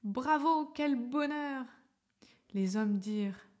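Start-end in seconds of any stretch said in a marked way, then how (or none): 0.0-1.6 high, louder bravo; B crying out with joy, higher and louder; joyful cry. Acted. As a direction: burst out in delight. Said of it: "B cria".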